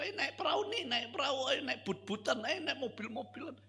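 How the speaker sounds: put-on high, sing-song coaxing of a child; coaxing maid. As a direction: lure the crying child with toys. (pembantu membujuk anak dengan suara tinggi)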